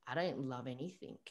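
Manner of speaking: soft, downcast confession; subdued admission. Retold or imitated. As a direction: drop to a quiet, saddened admission. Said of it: "demeanor just changed".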